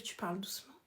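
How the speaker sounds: gentle hushed delivery; soft voice. Shown as a demonstration. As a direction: drop to a soft hush. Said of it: "Il faut apprendre à parler doucement".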